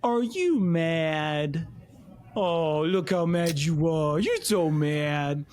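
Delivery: slow, drawn-out mock pity; teasing a pouting wife, slow; teasing tender husband. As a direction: coo it slowly like to a pouting child. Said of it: "I look at him and I go".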